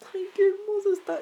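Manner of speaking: high breathy whisper of adoration; cooing. Real, not acted. Gushing to viewers about her beloved dog, whispered coo. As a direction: coo it like over a puppy.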